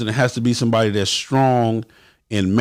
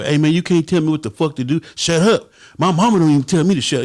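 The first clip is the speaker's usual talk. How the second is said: higher, petulant comeback; little boy attitude; 4 semitones higher than usual. Retold or imitated. Member answers the president like a little boy: high petulant retort. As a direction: whine back like a sulky kid. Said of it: "hit him with the little boy".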